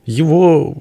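high, drawn-out wailing stammer; fighting back sobs. A narrator's reading. Peasant woman tells of the man taken away, fighting sobs. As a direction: choke the words out, stretching the last into a wail. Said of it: "борясь с рыданиями".

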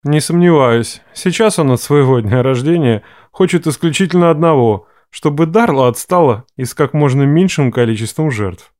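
dry low ironic voice; deadpan deep-voiced joke; low male voice. A narrator's reading. Ref's voice continues. (низкий мужской голос с иронией)

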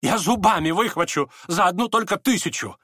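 high, excited bid; eager offer. A narrator's reading. Фердыщенко offers to snatch the packet, high and eager. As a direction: pipe up eagerly, pitched high.

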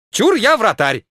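put-on high, loud childish call; loud playground shout; eager shout. A narrator's reading. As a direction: shout it like a kid claiming a spot. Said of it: "закричал Кальтенбруннер".

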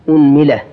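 أنملة said "unmila", u then i; u-i vowels. Shown as a demonstration. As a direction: open on u, then "mila". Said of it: "ضم الهمزة".

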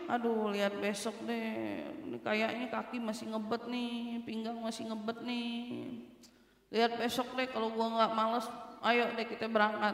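soft, sluggish put-off reply; lazy excuse-making. Acted. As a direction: act out someone too lazy to go. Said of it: "dari rasa males".

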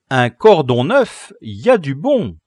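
loud, pleased exclamation; gleeful appraisal. Acted. Leader finding a new lace, loud satisfaction. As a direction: savour the find out loud.

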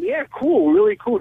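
high-pitched acted enthusiasm; retold excitement, pitched high; keen retold reply. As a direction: act out an eager caller, pitch jumping up.

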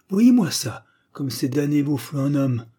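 high, loud frustrated cry; vexed grandfather bursting out loud; vexed outburst. A narrator's reading. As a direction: blurt it out in spite. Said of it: "dit mon grand-père, dépité".

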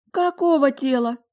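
high, loud alarmed question; frightened. A narrator's reading. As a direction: ask in startled fright. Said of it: "испуганно прошептала Фабиана". (испуганный вопрос высоким голосом)

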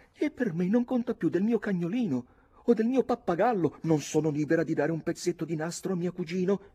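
put-on high, haughty girl's protest; high-pitched theatrical young woman; indignant young lady. A narrator's reading. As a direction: argue back like an affronted heroine. Nora argues with the captain.

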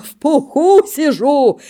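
gleeful despite the cold; весело; cheerful boast. Acted. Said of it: "А Косой весело".

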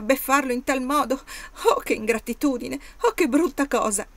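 high, loud tearful outburst; bursting into tears. Acted. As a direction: let the words spill out through sudden tears. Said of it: "scoppiando in lacrime".

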